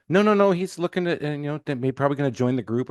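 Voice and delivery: retold high-pitched protest, easing off; hurried denial. Friend assures him the newcomer may join the group, retold protest.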